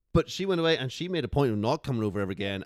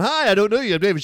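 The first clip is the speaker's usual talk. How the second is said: much higher, loud pick-up line; mock eager greeting; 9 semitones higher, 12 dB louder than usual. David retelling his fifth cheerful intro, high and loud. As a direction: blurt it out loud and chipper. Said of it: "of me going".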